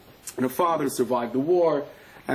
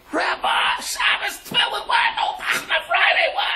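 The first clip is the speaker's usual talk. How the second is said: desperate shout. Acted. Soldiers begging the rabbi, shouting high and desperate. Pleading soldiers in war story.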